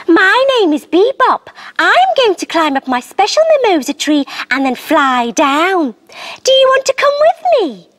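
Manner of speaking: put-on high, bright little frog girl. A narrator's reading. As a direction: voice a friendly tiny frog. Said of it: "said the little frog, smiling".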